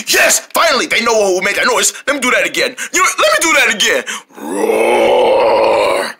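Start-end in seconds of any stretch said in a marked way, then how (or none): 4.4-6.1 one long low growled roar; mock bear roar. Bear roars again to frighten turtles, menacing.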